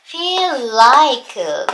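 "feel like" stretched slowly over one joined l; linked, drawn out. Shown as a demonstration. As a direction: draw out "feel like" as one continuous word. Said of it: "一つに聞こえるよってことですね". (「feel like」をゆっくり一つにつなげて発音)

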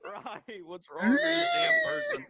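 slow, loud, cracking outraged yell; shaking with anger. Pretend. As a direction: yell it out, voice breaking. Outburst at avatar thief.

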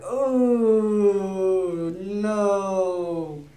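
high, loud drawn-out oh no; hammed-up oh no; mock dismay. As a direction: cry out in mock horror. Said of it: "I did that in my head".

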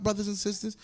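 usual speaking voice

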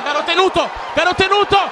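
high, disbelieving goal celebration; can't believe it, shout with joy; ecstatic goal call. Real, not acted. (gol gridato incredulo ed esultante)

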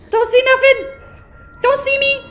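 high, loud begging yell; frantic high shout; panicked plea. Real, not acted. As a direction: plead not to be spotted. Hiding from monster, pleading.

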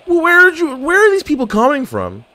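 panicked gamer caught off guard; startled. Real, not acted. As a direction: yelp as enemies pop up. Surprised by enemies appearing.

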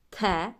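те with a firm hard т; hard т before е. Shown as a demonstration. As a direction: keep the т in те hard. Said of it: "як вимовляється твердість і м'якість".

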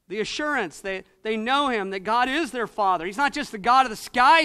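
ordinary talk, nothing marked

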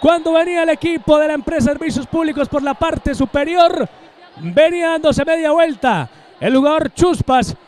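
high, loud goal call; breakneck goal commentary. Real, not acted. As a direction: scream the goal, stretched and soaring. Goal scored, commentator erupts.

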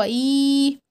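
a stretched, steady إي; long ii sound. Shown as a demonstration. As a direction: hold إي out long. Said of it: "هنطول صوتنا قوي".

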